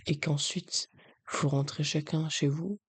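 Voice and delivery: normal talking voice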